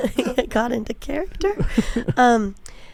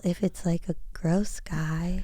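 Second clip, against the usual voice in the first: whispered aside, softer; 2 semitones lower, 6 dB quieter than usual. Real, not acted. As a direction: drop to a whisper for the punchline. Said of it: "whispered aside".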